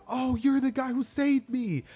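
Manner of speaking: light up with grateful recognition; excited recognition. Acted. Uraraka recognizing her rescuer.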